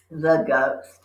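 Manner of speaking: ordinary delivery, nothing marked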